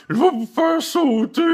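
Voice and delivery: very high, slow put-on shriek; mock dictator threat. Imitating Kim Jong-un's threats, hammed-up shriek. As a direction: ham up the ranting tyrant. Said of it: "il menace tout le temps".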